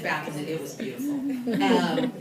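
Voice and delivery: ordinary talk, nothing marked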